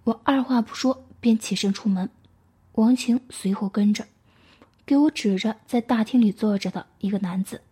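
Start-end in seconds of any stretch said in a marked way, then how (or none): none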